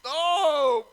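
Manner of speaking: sudden high shout; loud surprised oh. Real, not acted. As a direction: cry out in surprise. Near miss.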